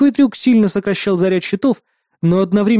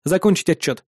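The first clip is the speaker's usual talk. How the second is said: curt. Acted. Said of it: "отрезал я".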